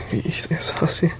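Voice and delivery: mock-dramatic whispered aside; whispering. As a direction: whisper it in playful despair. Mock complaint about greeting phrases.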